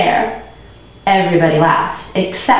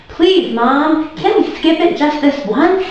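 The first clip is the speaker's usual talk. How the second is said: put-on high pleading child's voice; begging; 9 semitones higher, slower than usual. A narrator's reading. Boy begging his mom to skip the check, high pleading. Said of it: "Andrew begged".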